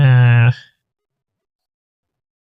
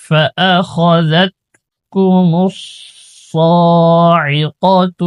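slow Quran recitation; 3 semitones higher, much slower than usual. Shown as a demonstration. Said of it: "teacher recites verse slowly".

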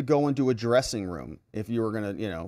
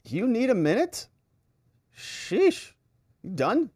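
high-pitched, sarcastic questions; mock exasperation; 8 semitones higher than usual. Lawyer sitting through Carroll's tirade, mock exasperated. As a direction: needle the witness with an exasperated sheesh.

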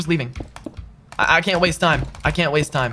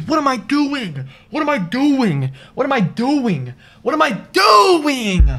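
slow, high, drawn-out groaning; building to a slow, loud shout; despairing self-rebuke; 8 semitones higher, 4 dB louder, slower than usual. Real, not acted. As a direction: ask yourself over and over in disbelief. Repeats self-rebuke after death.

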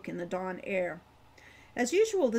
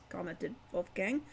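ordinary delivery, nothing marked

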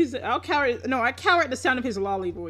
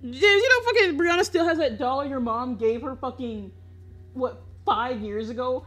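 usual speaking voice

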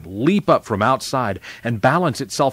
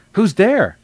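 high, louder startled query; calling out; 9 semitones higher, 5 dB louder than usual. A narrator's reading. Girl startled by a trumpet at the gate, calling out. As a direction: call sharply toward someone unseen. Calls to someone outside gate.

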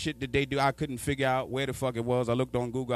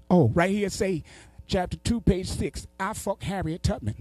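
higher put-on voice, halting as if reading; uncle reading aloud; 6 semitones higher than usual. Uncle reads from a book, mock high voice. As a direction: read it out as the uncle, pitched up.

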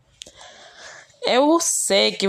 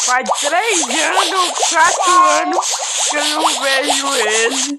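mock wail; 6 semitones higher, 3 dB louder than usual. Missing the pet, high wail.

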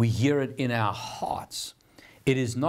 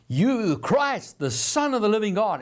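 blurted declaration; 10 semitones higher, 3 dB louder than usual. Acted. Peter telling Jesus who he is, blurting it out. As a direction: blurt it out, stretching the key words. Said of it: "it blurts out of Peter".

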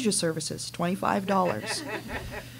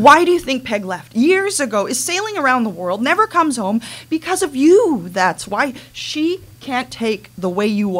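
louder and rising accusation; bitter escalating outburst; angry accusation; 3 semitones higher, 5 dB louder than usual. Acted. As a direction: let it build into a confrontation. Daughter confronts mother, escalating.

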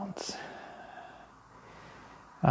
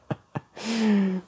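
high giggle; real laugh; 7 semitones higher than usual. Real, not acted. Too full to sit comfortably, amused laugh. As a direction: burst into a high little laugh.

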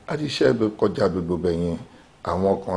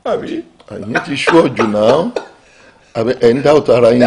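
much louder, higher, cheering; excited hype; 5 semitones higher, 12 dB louder than usual. Acted. Politicians hyping the next governor, loud excited. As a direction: pump each other up loudly. Politicians hyped about winning.

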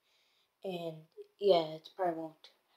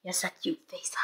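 soft cooing whisper; 4 semitones lower, 7 dB quieter, faster than usual. Real, not acted. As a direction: whisper fondly to the cat. Whispering to the cat.

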